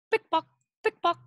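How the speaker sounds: high clicking "pick-pock"; high heels clicking. Shown as a demonstration. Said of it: "high heels go pick-pock".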